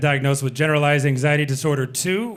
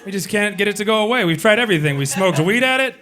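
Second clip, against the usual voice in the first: defensive protest; 5 semitones higher than usual. Retold or imitated. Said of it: "we were like".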